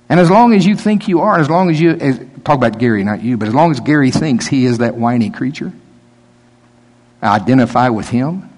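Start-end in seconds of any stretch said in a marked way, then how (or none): none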